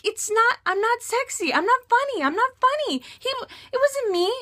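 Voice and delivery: very high-pitched repetitive whine; hammed-up self-pitying wail; super whiny. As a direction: whine each denial like you're about to laugh-cry. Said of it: "super whiny and annoying".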